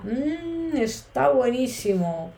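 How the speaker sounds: high, slow "mm" of pleasure; satisfied mm. Shown as a demonstration. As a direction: savour it, then praise. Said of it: "o una satisfacción". (satisfacción golosa)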